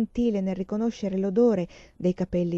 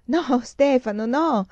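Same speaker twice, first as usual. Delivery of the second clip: louder, higher, drawn-out cry; cried out; 5 semitones higher, 4 dB louder, slower than usual. A narrator's reading. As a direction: call out slowly, pleading into the room. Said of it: "I suoi gridi rimasero". (grido prolungato, più forte e acuto)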